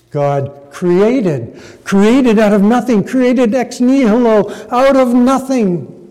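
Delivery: "created" punched, then repeated high and loud; stress on CREATED. Shown as a demonstration. Preacher zeroing in on the word, "created" hit hard. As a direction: hammer "created" each time, climbing in pitch and force. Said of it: "zero in on the word created".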